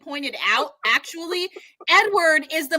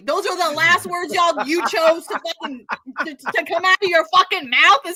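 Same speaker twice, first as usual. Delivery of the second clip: ordinary talk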